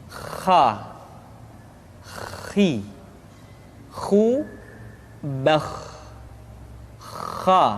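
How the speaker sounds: Kha said like a snore from the back of the throat; snoring throat sound. Shown as a demonstration. Teacher shows how Kha sounds like a snorer. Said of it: "seperti orang yang tidur dalam keadaan mengorok".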